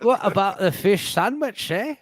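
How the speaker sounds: laughing mock Northern English accent; a laughing stab at a regional accent. Host laughs through a mock accent. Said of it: "doing these accents".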